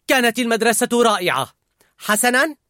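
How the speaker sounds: high, loud snap; sharp angry retort. A narrator's reading. Riley snapping at her mother, sharp and angry. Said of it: "بصوت حاد غاضب".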